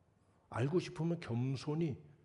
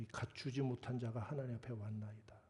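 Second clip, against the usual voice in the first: humble whisper; 3 semitones lower, 7 dB quieter than usual. Retold or imitated. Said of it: "와서 무릎 꿇어서".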